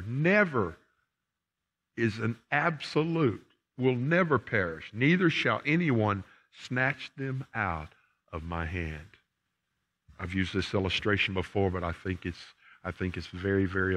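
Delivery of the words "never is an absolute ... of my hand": raised, higher preaching voice. Acted. Preacher pressing the verse on his congregation, raised and emphatic. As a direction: drive each word home from the pulpit.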